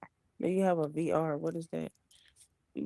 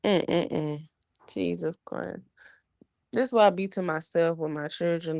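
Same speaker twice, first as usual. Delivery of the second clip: ordinary delivery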